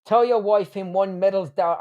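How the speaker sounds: hammed-up amateur Irish lilt; shitty Irish accent. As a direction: recite it in a rough mock Irish accent. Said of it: "in a shitty Irish accent".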